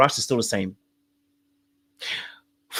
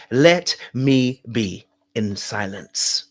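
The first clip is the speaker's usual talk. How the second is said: slow deliberate; 4 semitones lower, much slower than usual. Shown as a demonstration. Slow emphatic lesson line.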